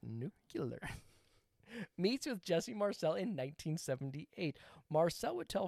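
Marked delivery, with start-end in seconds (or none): 0.0-0.9 "nuclear" drawn out as NOO-kyuh-ler, low and quiet; low, slow "nuclear" with "-kyuh-ler" ending; deliberate nucular. Shown as a demonstration. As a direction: insist on "nuclear" as nucular, slowly. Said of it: "It's pronounced".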